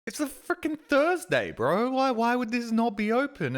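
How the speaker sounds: high, indignant complaint; exasperated disbelief. Retold or imitated. Streamer at the locked cafe door, high indignant complaint.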